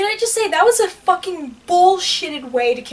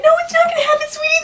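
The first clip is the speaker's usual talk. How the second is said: much higher, put-on sweet voice; imitated reassurance; 10 semitones higher, much faster than usual. Imitating friend soothing her over a spoiler, high mock reassurance. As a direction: mimic a comforter in a high pitch. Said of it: "I'm so glad you're like".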